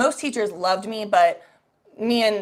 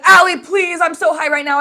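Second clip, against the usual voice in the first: high, loud desperate plea; panicked shout; 8 semitones higher, 9 dB louder than usual. Retold or imitated. Begging her friend Allie for help, panicked shout.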